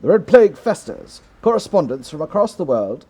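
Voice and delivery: a mildly put-on English accent, announcer style. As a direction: try a light English newsreader accent. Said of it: "I don't know if this is a good English accent".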